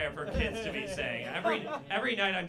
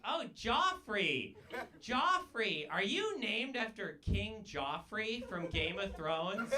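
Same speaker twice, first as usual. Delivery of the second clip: higher, drawn-out, slow mock cashier; 6 semitones higher, much slower than usual. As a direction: stretch "Joffrey" slowly like a puzzled cashier. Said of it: "She's like".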